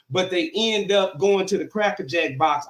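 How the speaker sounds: ordinary talk, nothing marked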